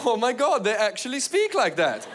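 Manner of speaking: high mock Norwegian accent; high, lilting Norwegian disbelief; shocked Norwegian. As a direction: gasp it as an astonished Norwegian. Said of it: "Norwegian people would have looked at each other".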